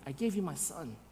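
low, very soft and grave; quiet, solemn divine answer; hushed reply. Acted. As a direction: say it almost under the breath. God's answer, first said quietly.

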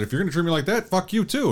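high, teasing retort; high, mock-offended retort; mock comeback. Host replaying his comeback, high and teasing.

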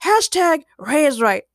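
high, proud declaration; triumphant. A narrator's reading. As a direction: gloat like delivering a catchphrase. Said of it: "Ray proclaimed triumphantly".

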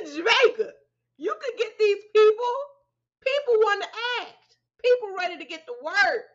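exasperated rising rant; incredulous rant. Real, not acted. Ranting about casting Jamaicans.

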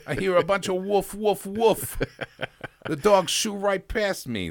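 high, slow mock New Jersey accent quoting the dog owner. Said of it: "I don't wanna do a New Jersey accent".

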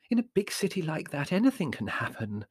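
darkly foreboding mother; hint at dangers, starting high and falling; ominous motherly worry. Acted. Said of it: "said Mother darkly".

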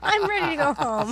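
laughing. Real, not acted. Laughing fit while recording.

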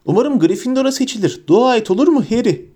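high, eager schoolboy plea; hopeful young fan, bubbling over; bouncing excitement. A narrator's reading. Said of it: "Adeta yerinde hoplayıp zıplayarak".

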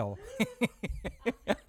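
high-pitched laughter; real high giggle; laughing. Real, not acted. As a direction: burst out laughing at your own joke. Laughs at own petrol joke.

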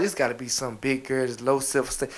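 low, hushed aside; retold private thought. Man recalls guessing about his friend's woman, hushed aside. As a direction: drop down as if thinking to yourself.

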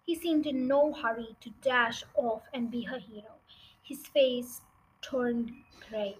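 ordinary delivery

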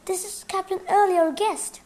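high, loud hurried protest; urgent exclamation. A narrator's reading. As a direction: exclaim it to stop a misunderstanding. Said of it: "Frank exclaimed".

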